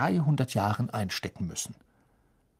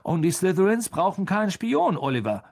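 lightly voiced, higher and louder knowing teaser; George, knowing; 7 semitones higher, 3 dB louder than usual. A narrator's reading. George teasing Oliver, higher and knowing. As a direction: set up the punchline with a smirk. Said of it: "sagte George".

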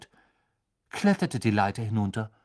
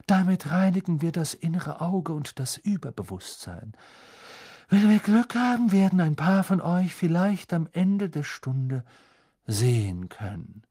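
solemn, higher-voiced fortune teller; mystic seer; 6 semitones higher, 4 dB louder than usual. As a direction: announce fate like an otherworldly seer. Trelawney voiced by narrator.

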